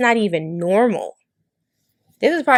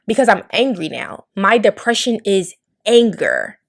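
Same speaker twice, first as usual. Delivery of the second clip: ordinary talk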